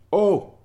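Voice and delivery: sudden high, loud "O" of pain; high, loud cry of hurt. Acted. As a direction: yelp it out like a startled little dog. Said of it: "riep mijn goedheid".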